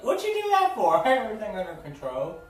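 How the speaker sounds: put-on high, loud, fast indignant kid voice; indignant little girl. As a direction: squeal it out like an offended little girl. Ikki indignant at rescuers.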